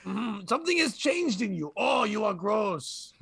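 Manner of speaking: disgusted mock Frenchman; French accent. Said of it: "answer in a French accent".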